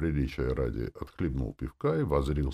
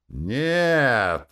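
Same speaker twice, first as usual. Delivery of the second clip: loud, raised shout; crowd shout; 8 semitones higher, 7 dB louder than usual. A narrator's reading. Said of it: "закричали из толпы". (громкий крик из толпы)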